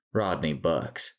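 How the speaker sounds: usual speaking voice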